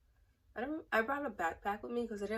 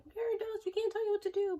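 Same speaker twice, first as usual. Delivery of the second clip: much higher mock sing-song staff voice; mocking program staff; 4 dB louder than usual. Mocking the program staff who insist they're adults, high mock voice. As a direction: parody the chaperones' fake permissiveness. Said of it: "they're like".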